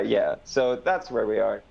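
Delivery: normal talking voice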